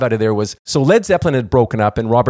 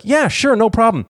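much higher airy breezy reply; breezy waiter; 10 semitones higher than usual. Retold or imitated. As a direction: toss it off lightly, no worries. Easygoing waiter agrees breezily.

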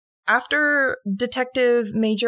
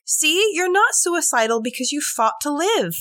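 mock proud killer; 8 semitones higher, 4 dB louder than usual. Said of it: "So he was like".

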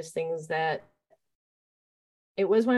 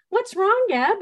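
put-on much higher, louder small child's voice; young kid asking; 9 dB louder, slower than usual. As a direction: ask like a worried little kid. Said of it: "looked at me and said".

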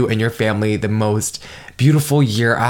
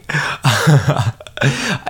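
laugh; 4 semitones higher than usual. Real, not acted. Laughing at own rant.

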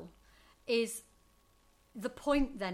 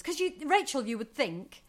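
usual speaking voice

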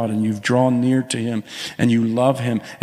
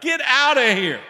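high ringing shout at the devil; shouted rebuke; 14 semitones higher than usual. Retold or imitated. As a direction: cast him out with a cry. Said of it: "you tell the devil".

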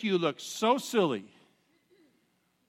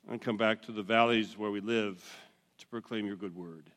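prayer; 8 semitones lower than usual. Acted. Pastor leads prayer.

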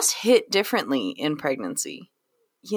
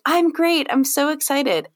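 high-pitched put-on enthusiasm; mock chipper; 9 semitones higher than usual. Prenatal patient answering an emotional check-in, mock chipper. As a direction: imitate a patient insisting all is wonderful.

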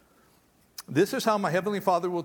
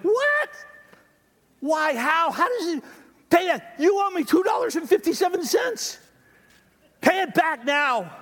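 much higher, loud, outraged yelling; furious outburst; 8 semitones higher, 3 dB louder than usual. Acted. Unforgiving servant yells at the man owing two dollars, furious. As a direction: explode at the debtor in disbelief. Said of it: "he demanded".

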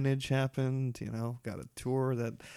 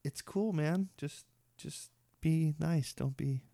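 higher, soft voice for a pet; gently hushing the dog, pitched up; coaxing a dog; 4 semitones higher, 4 dB quieter, slower than usual. Retold or imitated. Retells soothing his dog.

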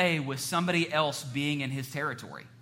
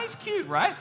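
cutesy high voice. Acted. Showing a cute puppy photo.

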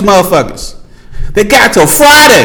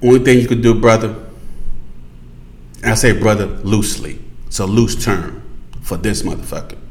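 low confiding advice; 11 semitones lower, 8 dB quieter than usual. Real, not acted. Mock advice to Trump, low.

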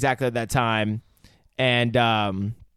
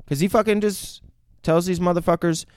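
normal talking voice

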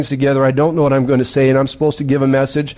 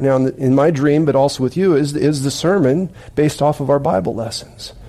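ordinary talk, nothing marked

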